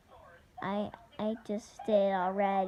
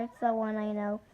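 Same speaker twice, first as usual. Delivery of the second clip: ordinary talk, nothing marked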